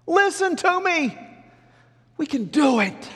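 high, loud exhortation; urgent plea. Acted. Joshua urging the people they can do it, urgent plea. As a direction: beg the crowd to believe.

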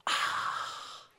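long breathy sigh after a drink; airy, satisfied post-sip sigh; refreshed "ah". Retold or imitated. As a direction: exhale a soft, drawn-out ahh. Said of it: "they just simply go".

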